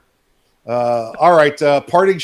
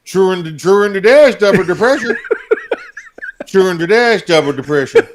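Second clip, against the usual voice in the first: loud, higher, cracking up through Truender dash dubba depressure; laughing repeat; 6 semitones higher, 7 dB louder than usual. Real, not acted. Co-host repeating the word while laughing. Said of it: "cohost repeats laughing".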